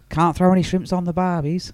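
high Aussie put-on voice; Australian accent. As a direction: play the Aussie cliche. Continuing his Australian impression.

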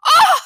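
high loud outraged gasp; woman shocked by banana on her suit; shocked cry. A narrator's reading. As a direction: cry out in dismay. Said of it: "she cried".

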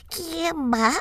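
anciana, aguda; voz de abuela. A narrator's reading. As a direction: abuelita en cama preguntando. (voz de abuelita)

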